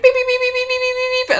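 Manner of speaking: very high beeping; imitated phone beep. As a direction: mimic the plain phone beep. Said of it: "some of the phones inside will be like".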